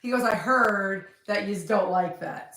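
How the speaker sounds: usual speaking voice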